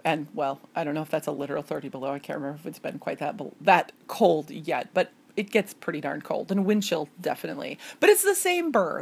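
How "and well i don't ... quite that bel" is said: ordinary talk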